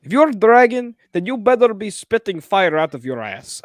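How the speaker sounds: put-on high, loud Russian accent; hammed-up Russian threat; mock Russian accent. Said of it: "Why did that sound Russian?".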